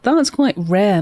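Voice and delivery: ordinary talk, nothing marked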